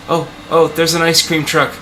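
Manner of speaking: high, loud excited cry; sudden eager shout; urgent calling out. Acted. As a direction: call after something driving away. Calls after an ice cream truck.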